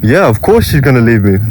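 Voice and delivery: ordinary talk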